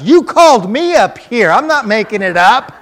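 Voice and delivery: high, yelled protest; indignant retort. Retold or imitated. Healed man protesting to his doubter, indignant shout. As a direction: shout back in your own defence.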